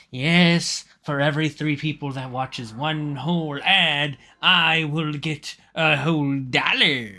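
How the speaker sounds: loud, high hammy pitch; excited. Acted. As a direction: announce it like a showman. Hammy greedy announcement.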